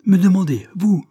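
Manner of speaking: loud, high incredulous outrage; affronted cry, pitch leaping up; indignant exclamation. A narrator's reading. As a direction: throw it back, scandalised. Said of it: "s'écria Tiffel avec indignation".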